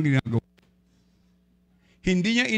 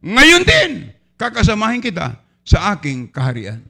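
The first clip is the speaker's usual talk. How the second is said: promise of Jesus; 7 dB louder than usual. Acted. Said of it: "Ano sabi ng Panginoon?".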